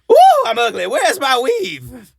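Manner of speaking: hammed-up falsetto; mock Madea voice. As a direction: whine it like a sassy old lady. Imitating Madea.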